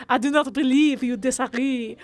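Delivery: hammed-up French accent, close to breaking into laughter; terrible French accent. Streamer reading Gaspard's game dialogue in a terrible French accent. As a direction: declaim in a badly faked French accent. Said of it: "my terrible French accent".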